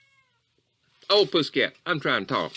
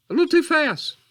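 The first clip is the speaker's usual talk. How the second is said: pitched way up and loud; sudden high, loud exclamation; high outburst; 7 dB louder than usual. Acted. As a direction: let it jump out high and loud. Repeats it, then apologises and redoes it.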